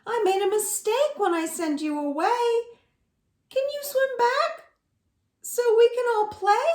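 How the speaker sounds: high, fast pleading; high pig voice pleading to swim back. Acted. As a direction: beg them to come back, high and quick. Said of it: "she says".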